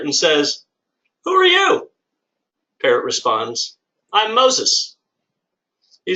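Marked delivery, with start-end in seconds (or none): 1.2-1.9 startled squeak. Acted. Burglar questions the parrot. 4.1-5.0 parrot voice. Acted. Parrot answers the burglar.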